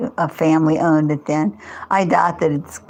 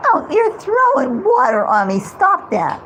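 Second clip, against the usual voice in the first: startled protest; 10 semitones higher than usual. Real, not acted. Cold water on her.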